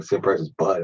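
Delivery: ordinary talk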